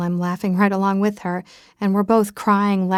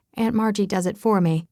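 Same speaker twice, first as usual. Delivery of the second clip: usual speaking voice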